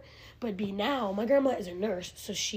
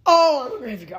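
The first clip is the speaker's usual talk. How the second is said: playful sing-song echo; 3 semitones higher, 13 dB louder than usual. Pretend. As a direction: toss it back like a jingle. Echoing friend's phrase playfully.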